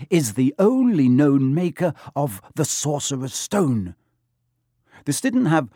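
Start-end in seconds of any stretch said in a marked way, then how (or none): 0.1-4.0 slow, raised stage whisper; dramatic whisper. A narrator's reading. Girl reveals the secret to friends, whispering dramatically. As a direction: unveil it slowly, with high drama. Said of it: "she whispered dramatically".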